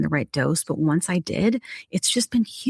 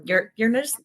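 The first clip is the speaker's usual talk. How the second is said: usual speaking voice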